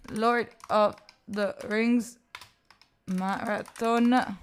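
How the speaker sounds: very slow, word by word; drawn-out words with gaps between; slow dictation. Real, not acted. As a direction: spell it out slowly as if typing. Saying words while entering a goal.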